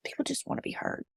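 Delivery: whispered echo, softly; whispered God. Retold or imitated. God's voice whispering the lesson to Rachel, hushed. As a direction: breathe the words like an inner voice. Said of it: "And he said".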